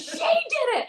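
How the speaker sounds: high, loud blaming yell; mock scream. Husband yelling at Walmart alarm, loud and high. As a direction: shout it to blame her and bolt. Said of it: "he just immediately screams".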